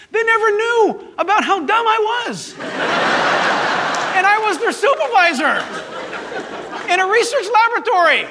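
high voice breaking into laughter; laughing. Real, not acted. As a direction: laugh at yourself while telling. Laughs about his own ignorance.

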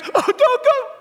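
very high-pitched imitation of begging; mock pleading. Everybody begging Paul to stay, in a high mock wail. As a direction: mimic a crowd's plea way up high.

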